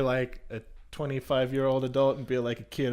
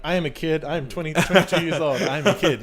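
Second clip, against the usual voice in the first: loud, higher, laughing protest; mock kid insistence; 4 semitones higher, 7 dB louder than usual. Friend jokingly insisting she's still a kid, laughing.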